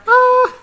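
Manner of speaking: a high, excited little yelp. Real, not acted. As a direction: squeal with delight at the reunion. Reunion scene, excited yelp.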